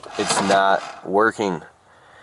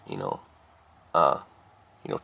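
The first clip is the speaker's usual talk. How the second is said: ordinary delivery, nothing marked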